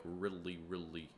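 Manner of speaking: exaggerated Minnesota accent on "really"; Minnesotan. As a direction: stretch each "really" in a mock Minnesota accent. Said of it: "Some people from Minnesota are".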